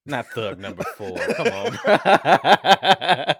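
laughing through the words; amused come on bro; laughing objection to a friend's joke; laughing protest. Real, not acted.

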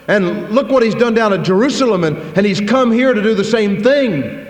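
a frightened servant arguing with God; anxious Ananias. Acted. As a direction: protest nervously, like a man afraid for his neck. Ananias pleads with the Lord.

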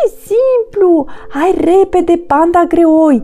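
high, loud, teasing monkey voice; cocky urging. Acted. Monkey brushes off Panda's warning, high and impatient. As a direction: breezily hurry the slowcoach along, pitched high.

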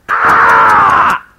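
high loud mock yell; a shaken man's cry; frightened cry. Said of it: "never been this frightened".

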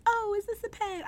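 a rough go at imitating a London accent; attempted London accent. British actor puzzling over a gifted pin, attempted London accent. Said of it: "I can't do an accent".

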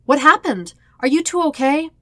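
anxious, loud check-in; worried. Acted. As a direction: rush in full of worry. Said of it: "Aang's worried voice".

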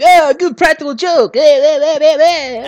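high, loud mocking quote with fake laughing; exaggerated high-pitched character voice; hammed-up movie character. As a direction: push it high, an over-the-top impression. Movie villain's fake laugh acted.

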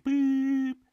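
high, loud machine beep; imitated beep. Mimicking the answering machine tone, high electronic beep. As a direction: squeak out a voicemail beep.

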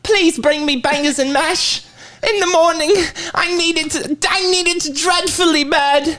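put-on very high, cowering mummy's child; whimpering little weakling. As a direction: plead pitifully in a squeaky put-on voice. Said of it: "in character of this little pussy".

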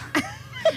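risita aguda. Retold or imitated. Imita risa contenida.

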